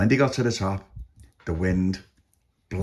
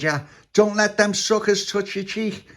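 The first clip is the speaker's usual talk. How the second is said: high, drawn-out puppy voice; warning puppy; 12 semitones higher than usual. A narrator's reading. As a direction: warn a friend, high and slow. Puppy warns Tony.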